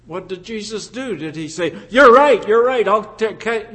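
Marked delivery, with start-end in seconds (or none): 1.9-3.7 mock agreement. Imagined reply to Pharisees, rejected.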